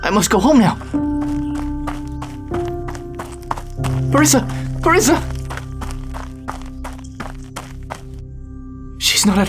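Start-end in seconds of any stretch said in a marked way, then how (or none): 4.1-5.2 high, urgent calls for a missing wife; anxious husband calling through the house. Acted. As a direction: call the name out, pitched high.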